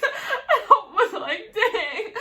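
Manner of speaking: high burst of laughter; laughing. Real, not acted. Retelling his tea threat, laughing hard. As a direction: laugh it out, amazed.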